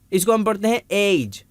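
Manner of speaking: usual speaking voice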